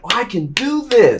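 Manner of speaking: high, loud eager self-quote; fired up. Retold or imitated. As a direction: pump yourself up like you can't wait. Retelling his own fired-up self.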